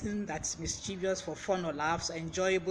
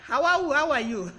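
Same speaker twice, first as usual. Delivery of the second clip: higher, much louder call-out with a laugh; delighted greeting; 7 semitones higher, 9 dB louder than usual. Real, not acted. Host spots a friend watching live, delighted loud greeting. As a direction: greet them loudly, breaking into laughter. Calling out to a viewer joining live.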